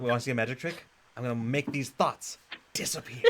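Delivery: Joker doing a magic trick, laughter creeping in; lightly voiced menacing movie villain; Heath Ledger's Joker. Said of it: "I'm hearing Heath Ledger's Joker".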